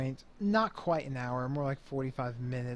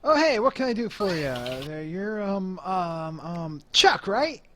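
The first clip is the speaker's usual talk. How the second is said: higher, louder, welcoming businessman greeting; hearty host fumbling for a name; genial club boss Mo; 5 semitones higher, 5 dB louder than usual. Acted. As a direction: greet him big, then hunt for the name. Game master plays Mo.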